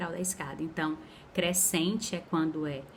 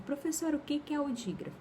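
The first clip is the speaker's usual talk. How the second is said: high, quick question, softer than the teacher; curious student; 8 semitones higher, 4 dB quieter, faster than usual. Pretend. Pupil interrupting the teacher to ask, high and quick. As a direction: pipe up like a puzzled pupil.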